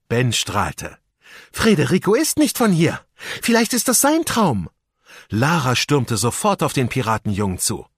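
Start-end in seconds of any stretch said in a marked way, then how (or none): 1.5-4.7 put-on excited girl. A narrator's reading. Lara excited realization.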